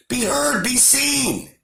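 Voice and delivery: a real angry outburst calling people to act. Real, not acted. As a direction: shout it out at the people in anger. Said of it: "Sorry for raising my voice".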